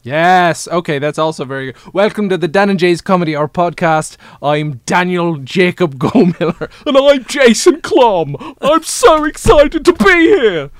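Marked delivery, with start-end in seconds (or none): 1.9-10.7 put-on gushing English accent host; John Oliver, English accent. English accent, John Oliver introducing both hosts excitedly. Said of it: "him keeping the English accent".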